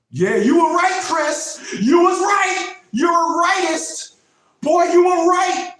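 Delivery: high, loud, gushing voice; a crowd that doubted him gushing that he was right, high and loud; mock admiring crowd. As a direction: play the people now eating their words, squealing praise. People admitting he was right.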